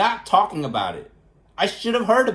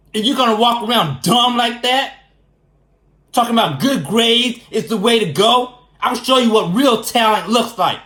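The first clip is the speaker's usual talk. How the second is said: angry rant; 3 semitones higher, 4 dB louder than usual. Real, not acted. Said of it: "But I am pissed off".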